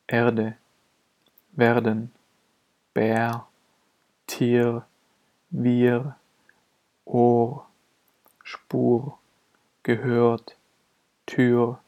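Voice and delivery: R turned into a vowel glide in "Bär", "Tier", "Tür"; vocalic R. Shown as a demonstration. Teacher giving examples after long vowels, R spoken as a vowel. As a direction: melt the R of "Ohr" and "Spur" into a vowel. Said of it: "Das R wird als Vokal gesprochen".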